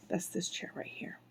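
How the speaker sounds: plain reading voice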